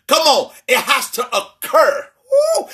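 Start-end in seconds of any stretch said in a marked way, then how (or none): 2.3-2.7 high whoop. Real, not acted. Preacher's ecstatic exclamation.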